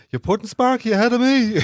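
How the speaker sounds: teasing impression in a Traveller accent. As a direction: put on a Traveller voice, breaking into a laugh. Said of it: "That's a great Traveller accent".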